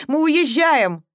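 mother announces they are leaving; firm declaration. A narrator's reading.

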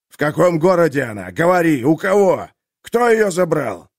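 rising furious demands; loud interrogation. A narrator's reading. Merchant demands answers from the jailer, loud and furious. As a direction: press him, harder each question. Harman interrogates, hammer raised.

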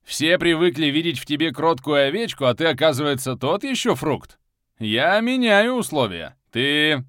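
loud, mocking dark lord; a lordly, ominous ruler. A narrator's reading.

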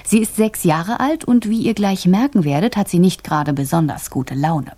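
ordinary delivery

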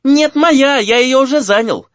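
put-on very high, squealing kid voice; high-pitched childish yelling. A narrator's reading. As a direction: protest like a child fighting over a room. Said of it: "спорили и вопили".

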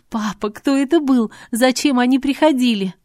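desperate to know; anxious questions. A narrator's reading. Daughter begs her bleeding father for answers, high and scared.

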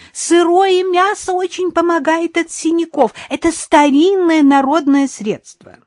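very high, loud call; shouting after someone. A narrator's reading. Mother-in-law calling after departing minibus, shouting. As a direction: yell the advice so it carries. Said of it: "крикнула она вслед отъезжающему микроавтобусу".

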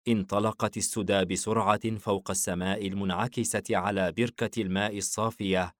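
plain reading voice

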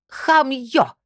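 high contemptuous bark. Acted. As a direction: spit it out with scorn. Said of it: "презрительно сообщила ему Аврора Илларионовна".

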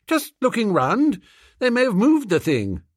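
put-on high, airy young gentleman; breezy innocence, aristocrat; innocent toff. Bertie feigns innocence.

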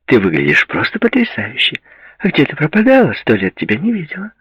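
put-on high, lively girlfriend voice; gushing friend. Girl greeting a long-unseen friend at the bar, gushing. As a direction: gush with pitch leaping upward.

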